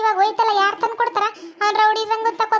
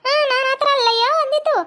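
higher, put-on voice of a nagging neighbour woman; shrill scolding village woman; 5 semitones higher than usual. Woman scolding the man for siding with her rival, in a high put-on voice. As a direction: play the fussing aunt, pitched up and complaining.

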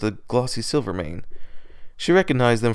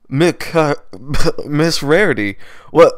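louder, higher, caught off guard mid-mouthful; startled sputter; 4 semitones higher, 8 dB louder, slower than usual. Acted. Startled Pegasus choking on rain, spluttering reply. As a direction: splutter through rainwater.

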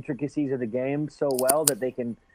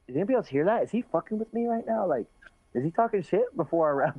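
higher, incredulous; baffled fighter asking the gym if he heard that; mock disbelief; 6 semitones higher, faster than usual. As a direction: act baffled by a trash-talk compliment. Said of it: "and I'm like".

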